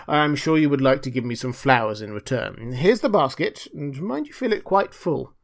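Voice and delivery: lofty, self-righteous voice; demanding miller. Acted. Miller handing Hans the basket, smugly demanding. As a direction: press the request as if owed.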